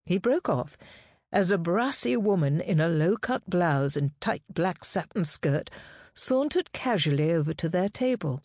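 usual speaking voice

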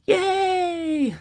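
very high, delighted squeal; child's cheer. Acted. Little girl celebrating the promised pickles, squealing with joy. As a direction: cheer like a thrilled five-year-old.

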